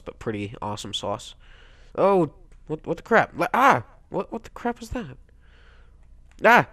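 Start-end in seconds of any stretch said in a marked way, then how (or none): 1.9-5.2 high, jumpy exclamations; baffled outburst, pitched up; startled confusion. Real, not acted. Startled by something in game. 6.4-6.7 short sharp cry; quick burst of alarm; startled yelp. Real, not acted. Startled while playing.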